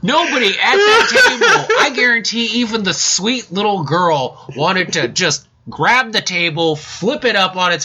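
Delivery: high and laughing through the words; ranting through real laughter. Real, not acted. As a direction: laugh so hard it almost cracks.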